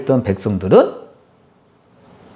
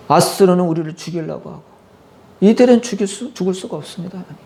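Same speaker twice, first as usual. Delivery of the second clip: higher, pleading cry to God; wailing prayer; 4 semitones higher than usual. Acted. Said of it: "통곡하며 기도했다 그랬어요". (하나님께 울부짖듯 애원하는 기도)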